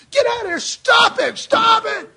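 high, loud yell to stop; imitating a desperate, forceful protest; acted frantic shout. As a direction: shout as if pushing back a gunman. Acts peace marcher pushing back gunman.

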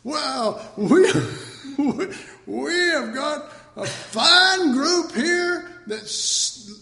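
high, drawn-out boasting with laughs breaking in; hammed-up, self-congratulating conference host; mock conference speaker. Preacher parodies the high point of conferences, mock speaker. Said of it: "That's the high point of some conferences".

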